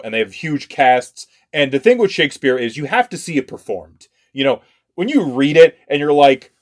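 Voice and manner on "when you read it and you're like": ordinary talk, nothing marked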